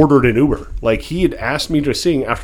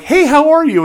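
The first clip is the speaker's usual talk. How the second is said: much higher, cheery hello; bright, high greeting; 6 dB louder than usual. Retold or imitated. Greeting the frightened Uber driver, high cheery hello. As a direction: greet her extra friendly to reassure.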